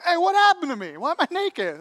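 high, loud indignant outburst; hammed-up angry demand; furious. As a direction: act out Noah waking up furious. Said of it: "he's, like, furious".

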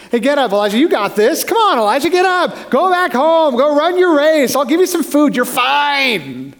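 high, rousing coach-like urging; brisk mock cheerleading, rising in pitch; imagined pep talk. What we expect God to tell Elijah, rousing pep talk. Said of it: "expect that God would say".

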